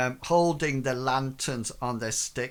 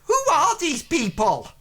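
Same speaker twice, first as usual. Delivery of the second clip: very high, loud merchant demand; indignant merchant; 13 semitones higher, 6 dB louder than usual. Acted. Merchant questioning the strangers, loud high indignation. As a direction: demand it as the put-out merchant, pitched right up. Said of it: "looks at you and says".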